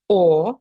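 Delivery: «о» said as a French nasal vowel, loud. Shown as a demonstration. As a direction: push the «о» into the nose. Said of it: "ми можемо сказати". (носовий французький «о»)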